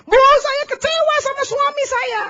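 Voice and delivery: high, slow put-on wife voice; imitating a wife complaining to the pastor. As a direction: play the upset wife at counselling. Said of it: "istri konseling sama saya bilang begini".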